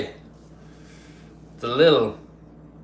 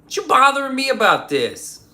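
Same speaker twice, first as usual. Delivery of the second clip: from a breathy start to a high, louder complaint; hammed-up exasperation rising high and loud; mock indignant outburst; 13 semitones higher, 4 dB louder than usual. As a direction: start breathy, then climb higher and louder in mock outrage. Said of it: "I'm like".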